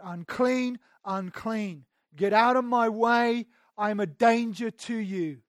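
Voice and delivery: loud, high warning cry, drawn out; lepers' warning shout. Retold or imitated. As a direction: shout it so people keep away. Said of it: "they would shout".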